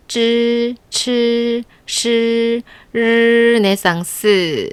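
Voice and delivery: 쯔 츠 시 르네상스 drawn out slowly; slow retroflex drill. Shown as a demonstration. Teacher slowly modeling sounds for students to copy. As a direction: slowly curl the tongue through each. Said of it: "르까지 같이 해보세요".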